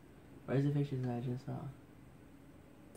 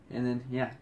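ordinary talk

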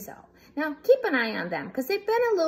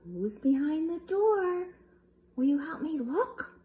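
usual speaking voice

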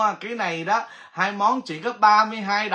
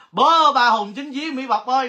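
calling out; 6 semitones higher, 4 dB louder than usual. Real, not acted. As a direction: drag out the call to find her. Calling the winner again.

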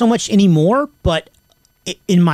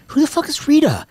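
higher, confused outburst; surprised; 9 semitones higher than usual. Retold or imitated. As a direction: ask as if you have never heard the name. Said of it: "And I was like".

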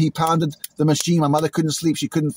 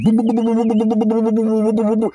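higher, louder string of booms; mock fireworks, booming; 6 semitones higher, 3 dB louder than usual. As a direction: chant booms like a barrage overhead. Imitating fireworks going off.

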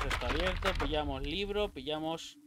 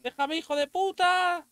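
panicked yell; 9 semitones higher than usual. Real, not acted. Monster chasing him.

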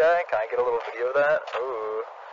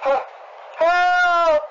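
desperate shout for help; 14 semitones higher, much slower than usual. Pretend. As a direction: scream for help as if trapped. Pretending to be trapped in a game.

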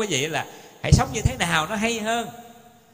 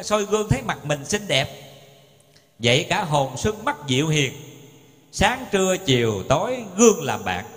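slow, measured verse reading; poem recitation; slower than usual. Acted. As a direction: recite each line slowly like a poem. (ngâm thơ chậm rãi)

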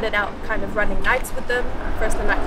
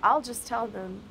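measured poetry performance with deliberate pauses; spoken-word poem; 4 dB quieter than usual. Acted. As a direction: perform it as slam poetry, rolling lists and weighted pauses.

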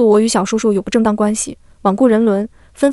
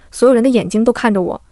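plain reading voice

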